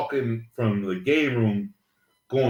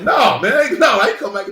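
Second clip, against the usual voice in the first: high, louder mock protest; indignant refusal; 9 semitones higher, 6 dB louder, faster than usual. The girl refusing to come back, a high indignant protest. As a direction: push back hard, pitched up. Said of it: "she's like, she's like".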